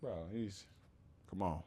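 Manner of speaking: ordinary talk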